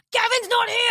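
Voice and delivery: high-pitched Scottish shopkeeper brush-off; Scottish accent. Retold or imitated. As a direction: imitate a curt Scottish shop worker. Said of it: "They just speak fucked up English".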